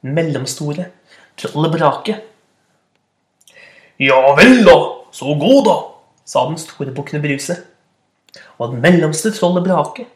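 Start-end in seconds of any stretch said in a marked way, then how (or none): 4.0-5.8 loud gruff dismissal; booming goat. Acted. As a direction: wave him on gruffly. Big goat dismisses troll. (barsk brølende bukk)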